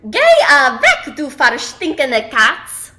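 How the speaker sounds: loud, emphatic storybook scream. A narrator's reading. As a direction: shout it to scare off a cat. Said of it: "Yetta screams at the cat".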